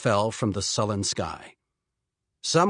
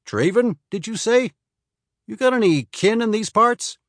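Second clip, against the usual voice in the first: townsman; 6 semitones higher, 5 dB louder than usual. Acted. Townsman with pipe voiced.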